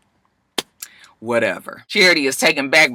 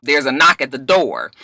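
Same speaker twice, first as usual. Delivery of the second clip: usual speaking voice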